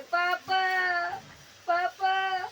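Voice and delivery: high, loud wailing calls; crying for papa. Acted. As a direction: wail for papa, loud and pitiful. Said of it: "तो फिर ऐसे करने लगे". (रोते हुए ज़ोर से पापा को पुकारना)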